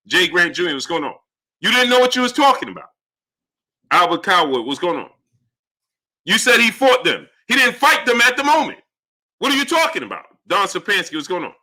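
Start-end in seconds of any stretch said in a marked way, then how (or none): 1.6-2.9 real exasperation, pitched up; heated scolding. Real, not acted. Scolding a viewer in an argument. 6.2-10.2 real exasperation, pitched up; heated scolding. Real, not acted. Arguing back at a viewer.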